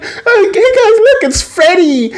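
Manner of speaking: very high, put-on excited squeal; high-pitched, hammed-up fan shriek; squealing fangirls. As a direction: imitate a gaggle of excited girls. Girls swarm the boy.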